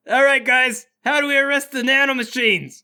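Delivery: high, loud shout to the team; Bart. Acted. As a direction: call out eagerly over the chaos. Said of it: "Bart goes".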